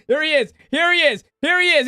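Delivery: high, loud sing-song repetition; mock announcement. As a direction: announce him mockingly. Imagining friend arriving.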